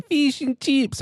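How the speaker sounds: very high Australian "chips"; mock Australian accent. Shown as a demonstration. Showing how Australians say it, exaggerated Australian accent. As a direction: squeeze "Fish and chips" up high, Aussie style. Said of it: "which one did they say".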